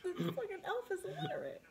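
high, laughing through the words; cracking up while talking. Real, not acted. Laughing retelling elf note.

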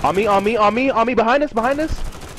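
panicked callout. Real, not acted. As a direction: yell for help in panic. Panicked callout under fire.

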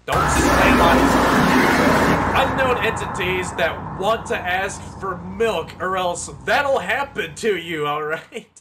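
shouting. Acted. Mock warning to the game character.

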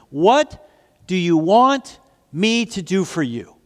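slow, emphatic, word-by-word asking; deliberate question. Acted. Jesus asks the blind man, slowly and deliberately. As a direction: lean on "want" and "me", pausing between phrases.

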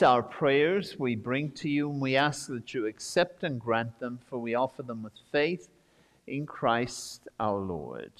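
ordinary delivery

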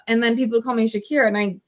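usual speaking voice

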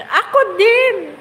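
high, slow, drawn-out cry of me too; excited outburst. Real, not acted. Livestream host hearing a viewer got blocked too, excited drawn-out cry. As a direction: stretch it out, half laughing, half outraged.

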